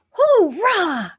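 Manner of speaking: cheerful high shout. Shown as a demonstration. Kids' song cheer, happy shout. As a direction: cheer it out loud. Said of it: "Give a shout".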